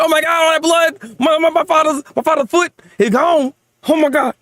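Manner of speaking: high-pitched hammed-up panic; mock freaking out. Reader imitates young daughter seeing father's blood, mock panic. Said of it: "she's kinda freaking out".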